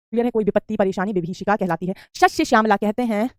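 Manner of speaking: normal talking voice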